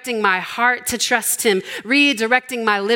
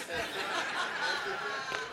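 laugh; 8 semitones higher, 12 dB quieter than usual. Real, not acted.

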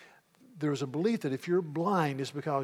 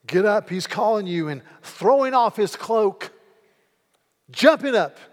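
rising louder and higher; excited build; 5 semitones higher, 9 dB louder than usual. A narrator's reading. Preacher telling the beggar's call to Jesus, excited. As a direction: let the excitement lift you.

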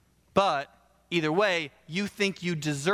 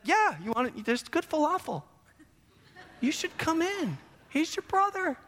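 high-pitched easygoing persuasion; lightly hammed cajoling; coaxing father; 11 semitones higher than usual. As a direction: coax a sulking son to join the party. Father coaxes older son, acted.

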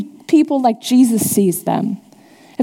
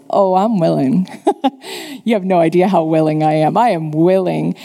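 low, laughing, warm reassurance; lightly voiced Jesus, delighted to heal; Jesus answering the leper, chuckling low and kind; eager willing Jesus; 6 semitones lower than usual. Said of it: "He says".

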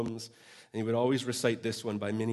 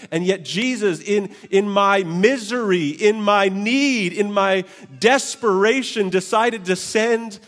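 higher, louder, slow climactic preaching; preaching at full force; 9 semitones higher, 12 dB louder, slower than usual. Acted. Preacher proclaiming Jesus met him in his misery. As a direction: build to the climax with passion.

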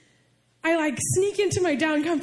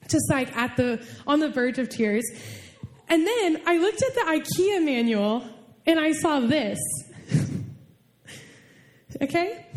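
normal talking voice

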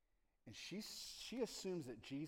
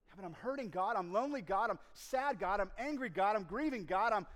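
loud, much higher lament; pleading outcry; 9 semitones higher, 13 dB louder than usual. Acted. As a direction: cry out to God in pain. Believer crying out to God.

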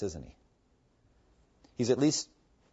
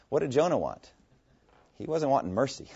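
normal talking voice

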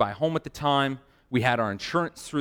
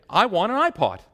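higher, emphatic mock demand; insistent demand; 7 semitones higher, 3 dB louder than usual. Customer demands iPod from manager, insistent demand. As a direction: put your foot down as the customer.

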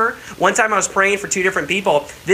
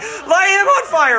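high, slow panicked cry; 4 dB louder, slower than usual. Retold or imitated. Man feeling heat while prayed for, panicked high cry. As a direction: shout it like the floor is lava.

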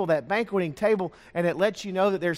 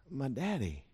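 normal talking voice

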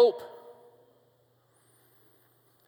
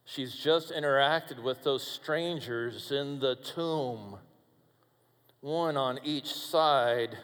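quiet narration; 7 semitones lower, 7 dB quieter than usual. Acted. Preacher quietly leading back to the empty tomb. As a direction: lower and softer storytelling. Quiet storytelling return to cemetery.

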